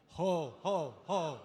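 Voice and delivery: loud ho ho ho from the belly; leading the standing crowd in laughter yoga, loud belly ho. Shown as a demonstration. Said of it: "we're gonna start down here".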